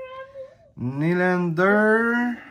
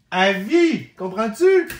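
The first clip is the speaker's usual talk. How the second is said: excited shout; 5 semitones higher, 7 dB louder, slower than usual. Real, not acted. Thrilled by card pull.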